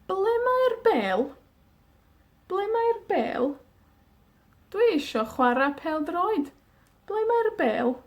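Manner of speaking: put-on high, questioning child looking for the ball; a child pleading for the ball, pitched high; eager child voice. Shown as a demonstration. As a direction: play a kid wanting to play football.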